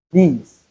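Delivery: Police with the lis syllable stressed; stress on second syllable of police. Shown as a demonstration. Teacher models Police with stress on lis. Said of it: "same thing applicable to police".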